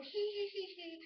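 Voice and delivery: high-pitched imitated giggle; mock girlish giggle. Girl walking away after poking a boy, giggly. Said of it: "walks away going".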